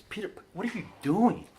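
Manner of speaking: lightly voiced Jesus asking; imitating Jesus. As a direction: ask it like a disappointed teacher. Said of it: "this is how I visualize it".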